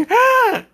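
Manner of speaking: a jolted, piercing scream; startled scream. Retold or imitated. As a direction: shriek as if caught snooping. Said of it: "She's like".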